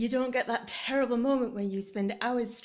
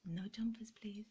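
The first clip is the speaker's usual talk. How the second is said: hushed, pleading aside to the baubles; whispered plea; 9 dB quieter than usual. Real, not acted. Owner whispering to her baubles not to fall off. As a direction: whisper no jumpers please under the breath.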